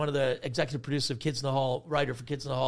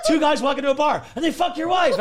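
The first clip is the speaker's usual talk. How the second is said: high, loud rapid-fire gag voice; gleeful joke-telling; 13 semitones higher, 10 dB louder than usual. Retold or imitated. Friends riffing jokes about the breakup, gleefully loud. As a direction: belt out setup and punchline.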